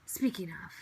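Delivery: usual speaking voice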